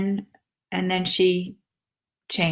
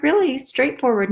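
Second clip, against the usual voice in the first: ordinary delivery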